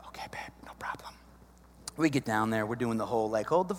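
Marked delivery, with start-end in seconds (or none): 0.1-1.1 hushed, fast whisper; whispered reply. Retold or imitated. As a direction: whisper it so she barely hears. Husband answers wife on the sly.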